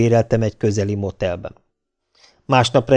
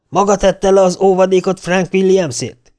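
raised, higher-pitched angry question; furious demand; 8 semitones higher, 3 dB louder than usual. A narrator's reading. O'Reilly confronting the bail bondsman, furious. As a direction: confront him, outraged. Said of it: "O'Reilly őrjöngve felkereste Óvadit".

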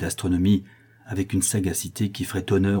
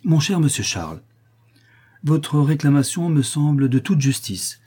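raised, higher public voice; out loud; 6 semitones higher, 3 dB louder than usual. A narrator's reading. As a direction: address the patient openly, pitch lifted. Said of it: "Le docteur reprit tout haut".

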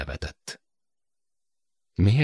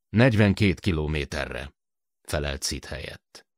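plain reading voice

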